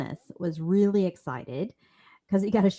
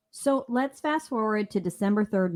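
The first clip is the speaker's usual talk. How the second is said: ordinary delivery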